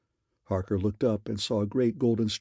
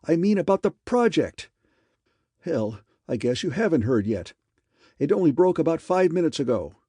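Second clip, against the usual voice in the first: excited; 6 semitones higher, 4 dB louder than usual. A narrator's reading. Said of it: "this excited".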